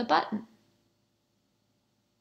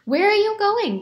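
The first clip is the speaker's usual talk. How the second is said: curious question; 11 semitones higher, 3 dB louder than usual. A narrator's reading. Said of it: "they asked".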